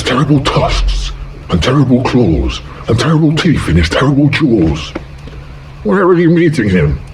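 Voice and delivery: high, bright mouse voice. Acted. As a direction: mouse teasing the fox. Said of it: "mouse voice, high".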